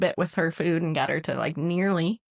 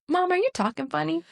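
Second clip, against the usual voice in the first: put-on child's voice; 10 semitones higher than usual. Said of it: "she'll go".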